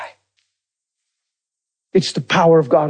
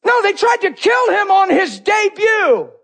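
high-pitched preacher's shout; impassioned shout. Real, not acted. Preacher shouts his punchline.

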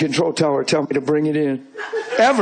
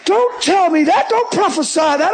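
high, loud, slowed alarm; shocked protest, loud and high; alarmed shout; 12 semitones higher, 4 dB louder than usual. Retold or imitated. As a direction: cry out in protest. Guy alarmed by the warning.

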